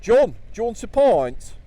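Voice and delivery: loud, high East Midlands pub voice. Retold or imitated. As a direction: call to Little John like a Nottingham local.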